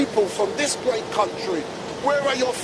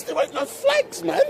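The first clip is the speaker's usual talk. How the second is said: much higher, jokey exasperated cry; mock outrage; 10 semitones higher than usual. Real, not acted. Interviewer playfully complaining to the crowd about flags. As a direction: play up the shock like a showman.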